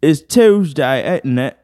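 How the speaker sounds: high, slow exaggerated British accent; mock British accent. Reading a British meme aloud, mock British accent. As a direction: drag it out in a hammed-up British accent. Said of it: "British people be like".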